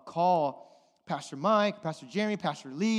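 ordinary talk

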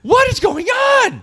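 very high, drawn-out mock shriek; slow, loud comic cry of disbelief; bewildered shout. Retold bewilderment, performed at full pitch.

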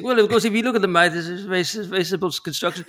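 lightly done Tolkien explaining myth; mild Tolkien voice in the house sketch; Tolkien impression. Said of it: "he's like".

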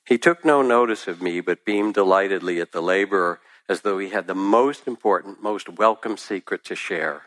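ordinary delivery

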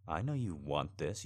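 ordinary delivery

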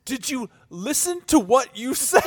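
high, loud, slow disbelief; incredulous. Retold or imitated. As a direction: draw it out as an astonished challenge. Friends confront her after the announcement.

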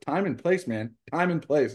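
high, emphatic mock reprimand; retold scolding. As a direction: imitate an exasperated manager's telling-off. Retells manager scolding him for throwing.

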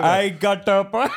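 loud, high attempt at a Jamaican accent. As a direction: belt out a dodgy Jamaican accent. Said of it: "it's like the Jamaican accent".

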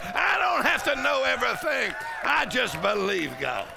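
high-pitched revival fervour; full-force preaching. Real, not acted. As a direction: build it fervently for the congregation.

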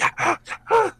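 high, held-back excited yelps; stifled midnight cheer. Retold or imitated. Fan celebrating at midnight without waking anyone, stifled yelps. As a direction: squeal while trying to stay quiet. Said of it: "You're trying to be quiet".

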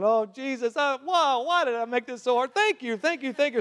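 high, breathless thanks to Jesus; overjoyed thanks. Retold or imitated. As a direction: pour out relieved gratitude. Grateful prayer on the drive.

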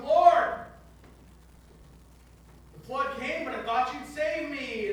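loud high cry of Lord, then hurt protest; complaint to God. Acted. Drowned man complains to the Lord, loud. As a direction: plead your grievance in heaven. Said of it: "he goes".

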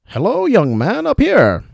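high, slow, fussy gentleman voice; dangling old traveler. Acted. William calling to Ricky from upside down, high and fussy. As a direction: call down politely from the rope.

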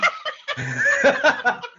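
helpless high cackle; high burst of laughter. Real, not acted. Co-host amused by threat to quit podcast, high laughter. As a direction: crack up in a high-pitched laugh.

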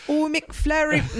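put-on slow, laughing try at an Irish accent; a second go at an Irish accent, slow and laughing; failed Irish accent. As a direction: stretch it out in a bad Irish lilt. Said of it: "No, damn".

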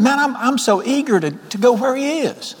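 high-pitched, yearning eagerness; acted eager longing. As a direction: voice an aching wish, pitch lifted. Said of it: "This man is aching to die".

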